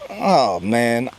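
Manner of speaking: ordinary delivery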